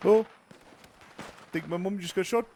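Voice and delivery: high, fast mock New Zealand accent; a chirpy Kiwi voice over bad news. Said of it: "What a funny language".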